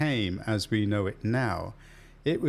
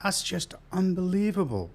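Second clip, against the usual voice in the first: put-on English accent, pitched higher; higher-pitched imitation English accent; 6 semitones higher than usual. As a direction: exclaim it in a mock English accent. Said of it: "that was a good English accent".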